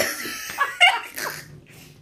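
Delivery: high airy laughter; wheezing laugh; breathless laugh. Real, not acted. Wheezing with laughter at the memory.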